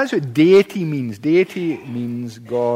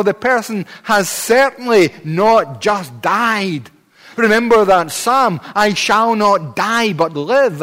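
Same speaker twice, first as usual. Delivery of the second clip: raised, forceful preacher's delivery; impassioned preaching; 4 semitones higher, 4 dB louder than usual. Acted. Preacher to congregation on death not being natural, impassioned preaching. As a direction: preach it with ringing conviction.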